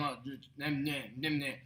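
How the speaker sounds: low, muttered dismissive imitation; sarcastic grumble, dropped low and soft; mock sarcasm. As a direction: quietly mimic bored, sarcastic naysayers. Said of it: "I certainly don't like the sarcasm from people's mouths".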